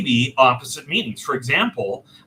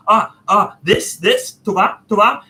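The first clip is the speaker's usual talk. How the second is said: raised, loud, eager query; eager asking; 6 semitones higher, 5 dB louder than usual. Retold or imitated. Customer checking the number on the calculator, eager and loud. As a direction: point at the number and ask. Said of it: "I was like".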